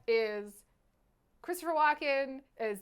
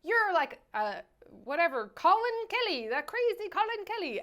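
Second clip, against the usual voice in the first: mock Walken quote; 6 semitones higher than usual. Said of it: "he was like".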